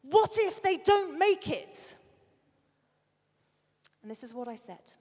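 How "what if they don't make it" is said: slow, high, loud frightened cry; child shouting. Retold or imitated. Son shouting from the other room, upset. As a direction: shout it like a scared little boy. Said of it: "he shouted".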